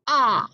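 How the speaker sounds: ah, the short a sound of octopus, open and clipped. Shown as a demonstration. Teacher modelling the short vowel ah for octopus. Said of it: "Let's try it again".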